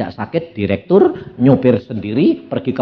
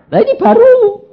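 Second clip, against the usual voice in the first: excited; 4 dB louder than usual. Real, not acted. Excited story turn.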